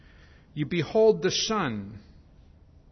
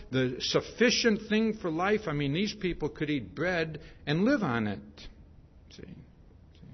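normal talking voice

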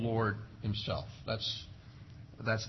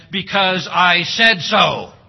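loud, slow "because I said so" with "said" hit hard; mock parent decree; 3 semitones higher, 11 dB louder, slower than usual. Preacher mimics parents laying down the law, loud and slow. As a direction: bark it like an annoyed parent. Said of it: "far different than".

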